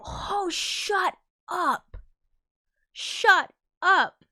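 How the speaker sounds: high, slow, crying-out "shut up"; can't believe what you just read; stunned disbelief. Real, not acted. Reacting to shocking chat fact.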